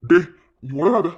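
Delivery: loud snap to stop the talk; boy snaps at the friend accusing Mahesh; angry rebuke. Acted. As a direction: cut him off loudly and angrily. Defends his friend angrily.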